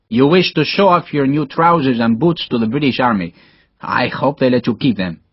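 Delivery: put-on accented Spanish officer, teasing his lieutenant. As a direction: play a proud Spanish comrade, fondly ribbing.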